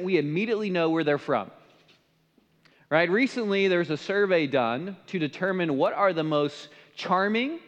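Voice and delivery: normal talking voice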